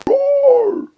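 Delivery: loud high mock roar; playful dinosaur roar. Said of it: "sounds like a dinosaur".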